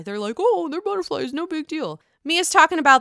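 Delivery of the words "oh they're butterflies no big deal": mock unconcern. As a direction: shrug it off in a higher voice. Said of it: "They didn't really care".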